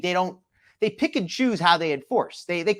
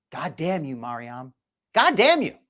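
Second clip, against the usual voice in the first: imitating an angry curse; 3 semitones lower than usual. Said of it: "I'm just gonna repeat it".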